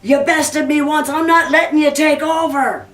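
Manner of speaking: angry refusal. Acted. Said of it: "the devil goes".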